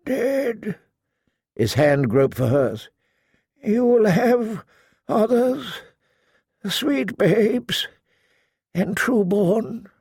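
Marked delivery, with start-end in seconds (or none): 0.1-0.8 high, weak, drawn-out groan. A narrator's reading. Dying lord answers his daughter, groaning. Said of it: "Lord Huster groaned". 3.6-9.9 high, slow, halting sickbed groan. A narrator's reading. Delirious lord consoles the woman he remembers, groaning. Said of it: "Lord Huster groaned".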